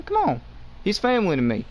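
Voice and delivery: ordinary talk, nothing marked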